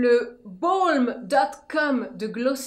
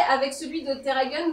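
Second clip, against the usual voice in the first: usual speaking voice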